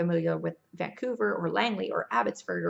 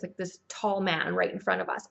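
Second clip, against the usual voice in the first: ordinary talk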